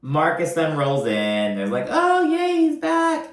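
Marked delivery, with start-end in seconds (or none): 1.9-3.3 much higher, sarcastic fake delight; mock cheer. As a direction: squeal it like a sarcastic party greeting. Mock cheer at Marcus's arrival.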